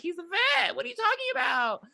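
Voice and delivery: very high, offended protest; indignant defense. Retold or imitated. Alice defending her boyfriend to the group, high indignant. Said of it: "she's just like".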